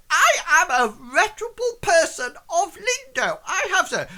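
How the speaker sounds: high, loud indignant protest; outraged thin man. Acted. Accused Clarence protests to the guards, high indignant outrage. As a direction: squawk your innocence, hammed-up.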